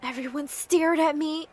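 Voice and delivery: ordinary delivery, nothing marked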